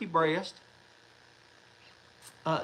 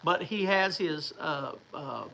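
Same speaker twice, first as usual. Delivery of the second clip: ordinary talk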